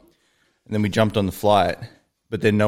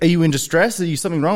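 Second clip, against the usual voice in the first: higher, louder imitation of a fussing flight attendant; 9 semitones higher, 3 dB louder, faster than usual. The flight attendant checking on a passenger who pressed help, higher-pitched. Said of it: "She's like".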